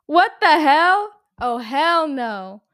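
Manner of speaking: loud, high, slow shocked outcry; drawn-out horrified what the hell. Real, not acted. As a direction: recoil from the words in disbelief. Reacting to dark quote.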